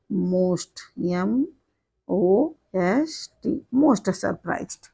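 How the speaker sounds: very slow, letter by letter, then the word; teacher dictating most surprised slowly; slow dictation. Shown as a demonstration.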